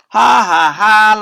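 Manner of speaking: loud high jeering ha ha; mocking laugh. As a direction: jeer at the girls. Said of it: "laughed the boys".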